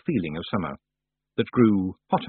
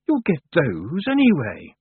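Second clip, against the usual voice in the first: confused and angry; 5 semitones higher, slower than usual. Acted. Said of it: "sounded both confused and angry".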